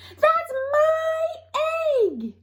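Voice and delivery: sudden very high mock shriek. A narrator's reading. Both hens claiming the egg, shrieking. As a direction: shriek it like two startled hens. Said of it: "Two chicken voices shrieked".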